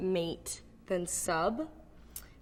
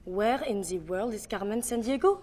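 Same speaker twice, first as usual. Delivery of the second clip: French accent, question lifting at the end. Shown as a demonstration. Accent coach demonstrating a card line, French accent. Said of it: "how to do a French accent".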